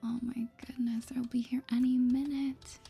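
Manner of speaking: normal talking voice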